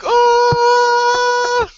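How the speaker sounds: long, high, loud fanboy scream; excited scream. Retold or imitated. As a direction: re-enact an overjoyed, drawn-out scream. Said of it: "I screamed".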